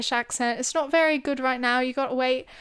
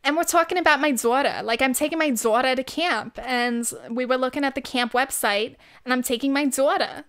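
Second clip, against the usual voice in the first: exaggerated Long Island mom accent; 2 semitones higher, 3 dB louder than usual. Shown as a demonstration. Chatting about summer camp as a Long Island mom, exaggerated accent. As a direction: lean hard on a Long Island "daughter". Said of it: "when I think of a Long Island accent".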